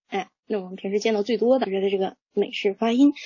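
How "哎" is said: normal talking voice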